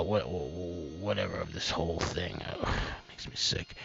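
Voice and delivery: low, very soft, fading to voiceless; disgusted murmur. Real, not acted. Commentator sickened by the whole thing, quietly disgusted. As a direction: let it sink away in quiet disgust.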